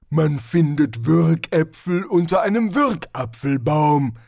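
slow, loud, lumbering barbarian voice; a big brute savouring his words; Hrun, the barbarian. Acted. Barbarian explains his logic.

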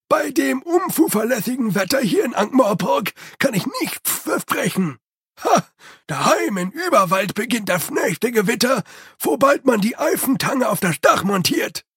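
a proud servant boasting about the storms back home; Igor's servant voice. A narrator's reading. Igor voice held, rising to shouts.

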